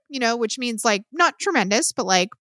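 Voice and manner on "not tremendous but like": ordinary talk, nothing marked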